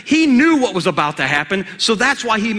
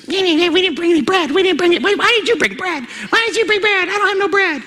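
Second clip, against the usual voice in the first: much higher, fast whiny squabble; clueless disciples; 10 semitones higher, faster than usual. Acted. Disciples bickering over bread, whiny and clueless. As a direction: play the dim disciples missing the point.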